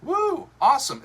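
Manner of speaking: high, loud woo; excited cheer. Real, not acted. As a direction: cheer them on. Cheering as friends arrive.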